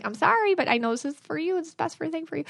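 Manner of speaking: high-pitched, placating apology; apologetic reassurance. Acted. Imagined apologetic but firm teacher.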